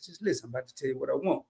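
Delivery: calmed down. Retold or imitated. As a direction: reel it in and coax him gently. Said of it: "I had to kinda calm down".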